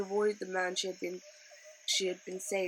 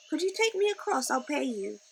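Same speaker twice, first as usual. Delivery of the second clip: put-on pitched up, eager girl asking; eager Leela; 6 semitones higher, 3 dB louder than usual. A narrator's reading. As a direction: plead a little for passage. Leela asks for a crossing.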